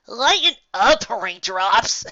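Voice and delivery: high, cocky pony, slow and loud on lighten up. Acted. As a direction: coax a grumpy friend with a grin. Rainbow Dash character voice.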